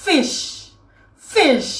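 "fish" said alone, falling, with a long sh; "fish" as a careful, isolated example word; model pronunciation. Shown as a demonstration. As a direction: model "fish" for learners, drawing out the final sh. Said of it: "อย่างเช่นคำว่า".

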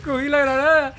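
laughing through the words. Real, not acted. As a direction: crack up while telling it. Retelling own grenade fail.

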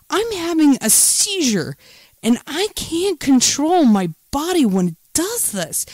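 re-enacted high, outraged tirade; retold furious outburst. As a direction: relive telling off the nurse, pitch shooting up. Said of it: "but I was like".

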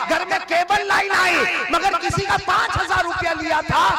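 high, loud rising sermon tirade; fiery preacher scolding the congregation; preaching at full force. Acted. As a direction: build it up like a fiery preacher rebuking the crowd. Preacher builds to a rousing tirade.

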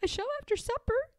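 high, quick and firm; decided. Acted. Pigwig tells Pigling her plan, high and decided. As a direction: settle it briskly. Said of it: "said Pigwig decidedly".

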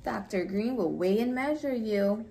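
ordinary talk, nothing marked

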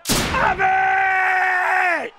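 long, high, loud goal yell; shout. Real, not acted. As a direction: scream it as the ball flies in. Shouts as he scores.

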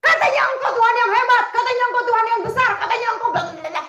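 loud, high taunting shout, fast; reenacted angry taunt at heaven; defiant shout at God. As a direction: hurl the challenge at God. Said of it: "saya tantangin tuh Tuhan".

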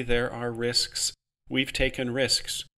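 slow, thoughtful; thoughtfully. Acted. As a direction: take your time, weigh it. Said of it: "Amy said thoughtfully".

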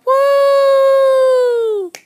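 high, loud whooping cheer; excited cheer. Pretend. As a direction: let out a big happy woo. Kid cheering in pretend movie.

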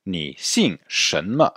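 Ni xing Shenme at under half speed; slow model question. Shown as a demonstration. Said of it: "Répétez". (Ni xing Shenme, très lentement)

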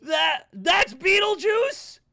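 loud, high, drawn-out cry of shock; shocked disbelief. Real, not acted. Viewer finds out that's Beetlejuice, loud shocked disbelief. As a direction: blurt it out high and loud, stunned. Shocked to recognise a changed character.

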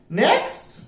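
high, rising parrot call; ordering parrot. A narrator's reading. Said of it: "ordered the parrot".

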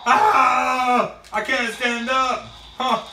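high-pitched pretend wailing over an injury; hammed-up hurt cries; mock agony. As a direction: whine loudly as if hurt in play. Toy hero hurt in play fight.

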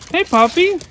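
high sing-song pet voice; talking to a dog. Real, not acted. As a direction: coo at the puppy. Greeting the dog Toby.

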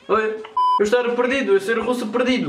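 put-on broken-Portuguese Russian accent. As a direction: play a lost Russian, heavy accent. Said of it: "com a famosa voz de russo".

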